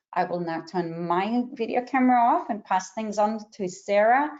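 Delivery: ordinary talk, nothing marked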